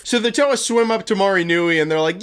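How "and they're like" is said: ordinary talk, nothing marked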